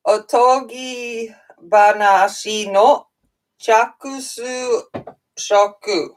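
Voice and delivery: "Otogi banashi no chakusu shoku" read slowly, syllable by syllable; halting Japanese. Real, not acted. Streamer reading a Japanese book title aloud, slow and unsure. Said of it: "that was really bad Japanese".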